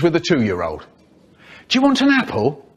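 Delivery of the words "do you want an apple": high sing-song parent voice. Acted. Parent talking to toddler.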